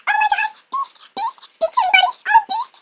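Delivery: very high, mock-desperate pleading; hammed-up distress over the wounded; distraught princess. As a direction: plead in a squeaky panicked voice.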